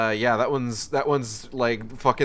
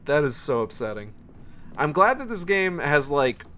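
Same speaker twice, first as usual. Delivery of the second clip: ordinary talk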